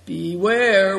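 acted note of warning. A narrator's reading. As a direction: caution them with a drawn-out word. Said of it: "warned Good Fortune".

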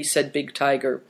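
ordinary delivery

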